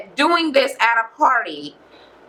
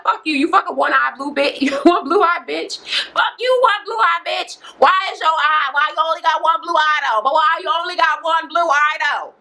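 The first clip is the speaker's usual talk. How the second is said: mock quarrel shout; 5 semitones higher than usual. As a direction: act out her taunting rant, pitched up. Acted insult in a retold quarrel.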